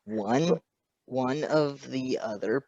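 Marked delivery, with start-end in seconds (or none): none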